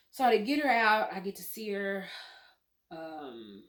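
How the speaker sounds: usual speaking voice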